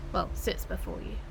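whispered aside. Acted. Villain quietly correcting her own grand line to the hero. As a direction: undercut yourself under your breath.

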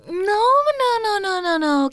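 high, loud run of protest, falling; whiny refusal. Acted. Girlfriend refusing kissy huggy make-up, high loud whine. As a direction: fend them off with a pouty wail. Refusing kisses in mock sulk.

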